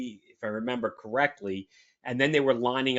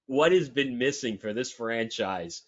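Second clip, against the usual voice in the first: normal talking voice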